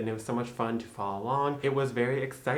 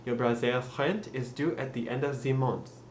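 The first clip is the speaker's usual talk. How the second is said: French accent, breathy R and TH as Z; French-accented practice sentence. Shown as a demonstration. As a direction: say it French, "month" ending like "mons". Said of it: "So to practice that with a French accent".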